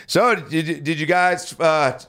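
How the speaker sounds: high, halting stammer, mock panic; acting too high to finish a sentence; tongue-tied. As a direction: struggle to get the question out. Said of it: "I couldn't get anything out".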